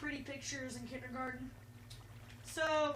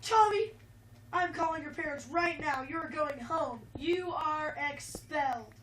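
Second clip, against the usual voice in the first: louder, sharp scolding, slowing down at the end; angry principal; 2 semitones higher, 7 dB louder than usual. Acted. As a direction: scold him like a furious principal. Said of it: "and he said".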